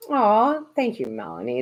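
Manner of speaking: ordinary talk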